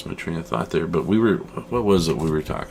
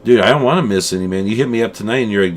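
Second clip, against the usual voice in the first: normal talking voice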